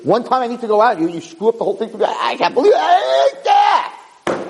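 hammed-up tirade climbing to a high-pitched shriek; retold screaming rant. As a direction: mimic a husband losing it, building to a wordless yell. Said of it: "he's screaming and he's yelling".

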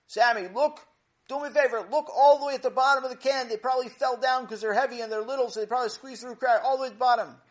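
high, loud call down the stairs; calling from upstairs. Acted. Father calling downstairs to Sammy, loud and high. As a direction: project it to a kid far below.

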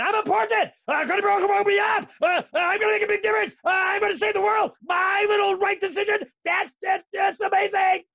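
high, loud mock self-importance; hammed-up arrogant inner voice, high and shouting; puffed-up boasting. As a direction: crow about your own greatness, overblown. Acting an inflated, self-important inner voice.

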